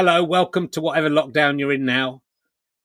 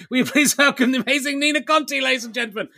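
high-pitched MC's welcome; guest introduction; 8 semitones higher, faster than usual. Acted. Host bringing on the amazing ventriloquist, announcer welcome.

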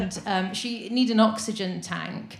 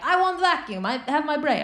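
imitating an old Russian carer, pitched up high in a thick Russian accent; 7 semitones higher than usual. Old Russian carer refusing her boss, in a put-on Russian accent. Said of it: "I shouldn't be doing the accent".

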